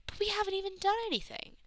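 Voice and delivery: high-pitched, startled objection; aggrieved protest. A narrator's reading. Donna protests as police rush towards them, aggrieved protest.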